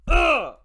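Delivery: loud, high "ugh" of disgust; disgusted groan. Real, not acted. Disgust at villains.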